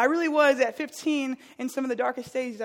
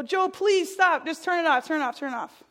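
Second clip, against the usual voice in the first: imitating a scared little kid begging, higher; pleading child; 5 semitones higher, 4 dB louder than usual. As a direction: beg big brother to switch it off. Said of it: "and I'm go".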